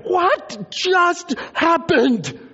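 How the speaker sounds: slow, high, loud cry; stunned disbelief. Acted. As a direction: draw out each word in comic shock. Acting out disciples' shock at vanishing.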